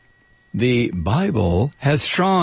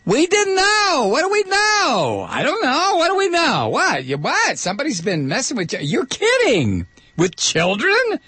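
mock Vatican defense; 13 semitones higher than usual. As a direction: play dumb in a squeaky falsetto, drawing it out. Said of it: "the Vatican's defense in this particular case was".

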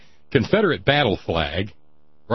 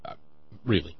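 ordinary talk

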